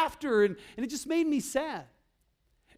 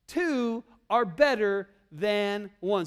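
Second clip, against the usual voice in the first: leading the memory verse very slowly; slow, word by word for the children to repeat; slow recitation; much slower than usual. Shown as a demonstration. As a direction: say each word slowly so they can follow. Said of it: "So say this with me".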